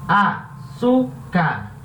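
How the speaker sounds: "Açúcar" very slowly split as a, çú, car; "Açúcar" sounded out slowly, a pause after each syllable. Shown as a demonstration. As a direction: break "Açúcar" into three syllables with clear pauses. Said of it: "A sílaba tônica é a sílaba".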